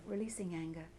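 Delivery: a quiet, falling second repetition. Shown as a demonstration. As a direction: let it fade as you let go. Repeated tapping mantra.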